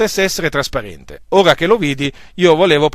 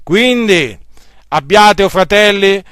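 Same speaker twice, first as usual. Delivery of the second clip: preaching at full force; 2 semitones higher, 4 dB louder than usual. Acted. Preacher urging the brethren to trust God's word, full-force preaching. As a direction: thunder the truth of Scripture at the congregation.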